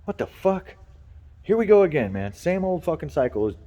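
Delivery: exasperated grumble. Retold or imitated. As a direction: vent like it's the hundredth time. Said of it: "I'm sitting here in the corner going".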